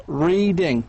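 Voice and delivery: "Reading" split as rea-ding; two syllables. Shown as a demonstration. Teacher points out two syllables, each one separated. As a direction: give "Reading" two distinct beats. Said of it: "two syllables right here".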